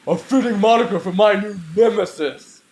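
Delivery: loud, booming demon declaration; deep demon voice. Acted. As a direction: intone it like a demon. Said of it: "oh, sorry, this is [name]".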